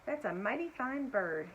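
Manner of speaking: ordinary delivery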